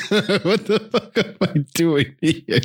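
slow, high, laughing through the words; laughing disbelief. Retold or imitated. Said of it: "I said".

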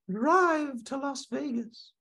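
very high, slow, eerie little voice; a small ghostly voice inside his head; tiny inner voice. Acted. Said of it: "little voice in the back of his head".

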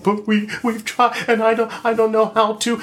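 high, pleading mock sob voice; a lightly acted tearful plea; imitating crying people. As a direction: play someone helpless and near tears. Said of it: "people who are crying and saying".

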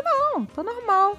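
a hint of forced cheer; fake-fine daughter. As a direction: brush off dad's worry. Daughter hiding sadness.

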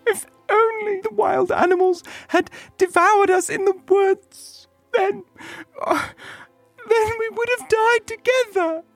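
very high tearful wail; hammed-up, halting sobbing; crying little girl. A narrator's reading. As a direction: lament in a tiny high child's voice. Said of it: "she cried".

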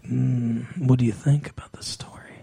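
low, whispered imitation of a creepy pass; whispered come-on. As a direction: whisper it suggestively, acting out his pass. Acting out man hitting on her.

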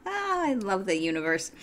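said through a wry laugh. Real, not acted. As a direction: still chuckling at the irony. Laughs at her back injury irony.

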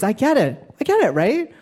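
usual speaking voice

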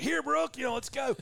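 high sing-song call to a dog; high-pitched dog call. Retold or imitated. Calling the dog in woods.

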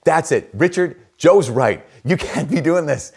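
loud, high-pitched telling-off; imitated scolding. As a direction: play the coworkers rounding on their friend. Retold coworkers scolding Richard.

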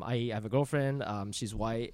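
low, soft, understated delivery; throwaway joke. Shown as a demonstration. Comic demonstrating an old open-mic joke, throwaway. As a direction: toss the punchline away quietly. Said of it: "I used to have this throwaway line".

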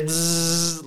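a droning bzzz like a motor; blender buzz. Retold or imitated. Imitating the NutriBullet whirring at four in the morning, a buzzing drone.

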